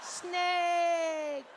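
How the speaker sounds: very high, drawn-out mocking laugh and taunt; jeering French kid. French boy taunts the frightened man, gleeful high jeer. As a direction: stretch each word in a high French-accented jeer. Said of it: "And this kid's like".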